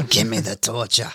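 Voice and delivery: laughing through a mock New York accent; New York accent slipping out between laughs. Repeating the line for his cohost, laughing in a New York accent. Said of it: "Why do I sound New York?".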